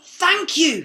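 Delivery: very high, loud cry; freed prince crying thanks; cried out. A narrator's reading. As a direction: burst out gratefully. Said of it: "he cried".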